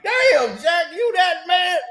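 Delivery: high, loud mock disbelief; mock incredulous. Host retelling his reaction to an angry apology, hammed-up astonished shout. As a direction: cry it out as if you can't believe it.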